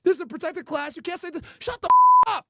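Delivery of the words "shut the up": much higher, much louder screech; shrieking yell. Acted. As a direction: shriek it like a sudden meltdown. Shrieked outburst.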